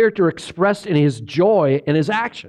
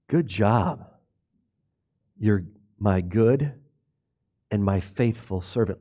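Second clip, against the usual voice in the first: soft, low and tender, like a proud father; quietly commend a faithful servant; Jesus praising a faithful servant, lower and softer; gentle praise; 13 semitones lower, 7 dB quieter than usual. Pretend. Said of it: "He's gonna sit down with you ... and say".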